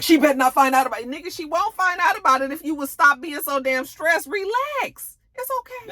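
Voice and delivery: high fast exasperated voice; mock exasperation. Tariq telling Kane to relax, high exasperated voice.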